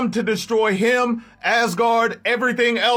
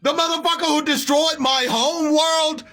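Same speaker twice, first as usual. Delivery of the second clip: higher, shouted betrayed outrage; furious Bill; 5 semitones higher than usual. Acted. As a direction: yell it in disbelief at a traitor. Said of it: "smacking the shit out of Thor like".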